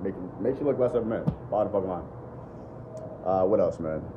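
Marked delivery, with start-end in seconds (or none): none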